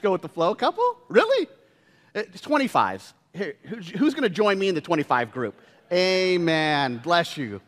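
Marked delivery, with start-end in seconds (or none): 0.5-1.5 high, loud incredulous squeal; mock surprise. Real, not acted. Pastor reacting to the fives in the room, mock shocked. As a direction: feign shock at the raised hands.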